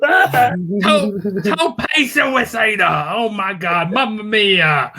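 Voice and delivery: high, slow, stretched-out wrestling call; mock commentator yelling. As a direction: parody an announcer losing it at a dive. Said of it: "Just an entire show of yelling".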